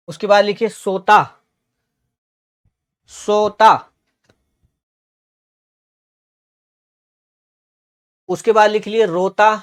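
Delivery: teacher calling out words to copy down; slow dictation. Real, not acted. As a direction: dictate each word clearly, then wait for writing. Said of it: "धीरे धीरे बोलूंगा".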